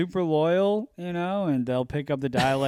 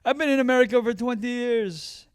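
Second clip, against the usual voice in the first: higher-pitched imitated Ghanaian accent; 8 semitones higher than usual. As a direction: imitate a Ghanaian Uber driver. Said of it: "some guy from Ghana".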